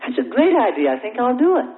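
high-pitched dawning "oh, yeah"; sudden realization. Acted. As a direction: act out an idea just landing. Acts out sudden bright realization.

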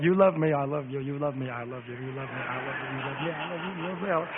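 very soft, low, fading murmur; softly repeated love words. Retold or imitated. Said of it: "All I felt like saying was that".

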